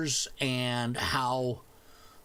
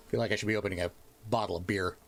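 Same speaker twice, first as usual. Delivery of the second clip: normal talking voice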